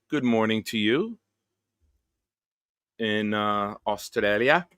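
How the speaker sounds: a rough Australian accent. Shown as a demonstration. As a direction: greet them in an Australian accent. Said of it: "It's not my best Australian accent".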